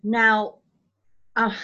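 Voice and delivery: ordinary talk, nothing marked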